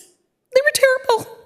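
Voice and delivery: pushed out slowly through a sob; crying. Real, not acted. Recalling hateful comments about her friend, voice breaking in real tears. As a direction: voice breaking high on terrible. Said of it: "I'd be crying".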